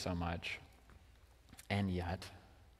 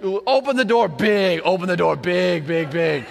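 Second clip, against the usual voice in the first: big raised voice, stretching the words; child calling out; 6 semitones higher, 8 dB louder than usual. Retold or imitated. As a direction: imitate a scared kid demanding loudly. Son calling as parents leave.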